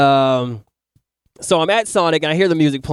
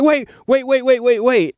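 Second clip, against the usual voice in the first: frantic wait; 7 semitones higher than usual. Retold or imitated. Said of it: "burst open the door".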